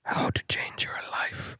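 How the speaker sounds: breathy whispered title; whispered book title. Pretend. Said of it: "Are you gonna write a book?".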